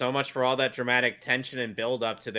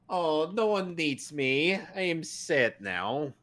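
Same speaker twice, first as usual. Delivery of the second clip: slow, mopey mock Russian accent; botched Russian-sounding accent; 4 semitones higher, 4 dB quieter, slower than usual. Viewer voicing a lonely Nightcrawler, sad Russian-sounding accent. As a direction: draw it out sadly in a bad Russian accent. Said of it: "That was a Russian accent".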